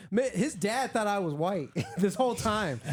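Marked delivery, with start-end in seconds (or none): none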